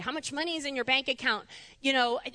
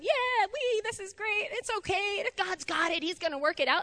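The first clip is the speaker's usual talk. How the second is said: giddy cheer; 7 semitones higher than usual. Retold or imitated. Cheerful good-day inner voice.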